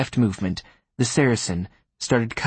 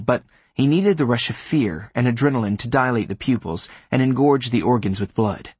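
ordinary delivery, nothing marked

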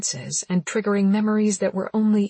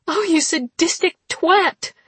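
furious shout; 11 semitones higher, 6 dB louder than usual. Acted. Heroine cuffed to bed.

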